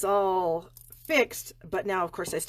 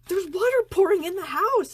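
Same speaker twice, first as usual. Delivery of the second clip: higher and louder, urgent news; frantic alarm; 11 semitones higher, 6 dB louder than usual. Retold or imitated. Mother telling her daughter about the flood: high, loud alarm. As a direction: blurt out the flood in a panic. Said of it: "And I'm like".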